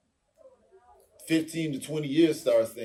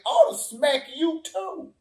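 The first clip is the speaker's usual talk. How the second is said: mock threat; 12 semitones higher than usual. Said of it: "low-key looking at Chris Rock like".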